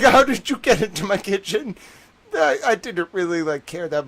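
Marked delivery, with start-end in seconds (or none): 0.0-1.8 louder, higher baffled asking; mock bewilderment at the intruder; baffled question. Retelling his baffled question.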